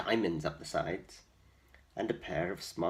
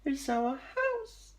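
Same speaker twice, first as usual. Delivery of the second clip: much higher, slow squeaky mouse; squeaky mother mouse; slower than usual. A narrator's reading. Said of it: "squeaked the mother mouse".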